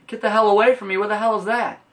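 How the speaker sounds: high, loud mock yell of disgust; freaked-out yell. Reindeer kids recoiling from Rudolph's nose, high loud mock yell. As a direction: recoil and holler at the glowing nose. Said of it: "everyone's like".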